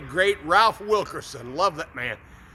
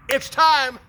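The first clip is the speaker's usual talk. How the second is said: declaring; 6 semitones higher, 5 dB louder than usual. Acted. God declaring the long-awaited miracle, ringing and loud. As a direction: proclaim it high and loud.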